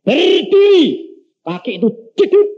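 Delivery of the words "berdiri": slow, high, stretched command; a slow, solemn high call to stand; God commanding. Retold or imitated. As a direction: draw out the word like a divine order. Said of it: "Tuhan bilang".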